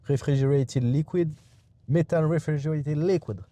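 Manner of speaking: reading the label in an English accent; light put-on English accent. Shown as a demonstration. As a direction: say it the English way, "refrigerated liquid". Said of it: "On essaie de faire avec l'accent anglais".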